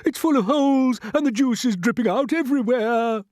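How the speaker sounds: loud, high alarm; shouting. A narrator's reading. Grasshopper describing the leak, shouting. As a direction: keep up the alarm. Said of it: "shouted the old green grasshopper".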